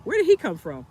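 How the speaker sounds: mock startled whoa at the man out of nowhere; retold surprise. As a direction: caught off guard by a stranger appearing. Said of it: "And I'm like".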